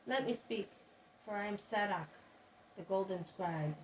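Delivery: ordinary delivery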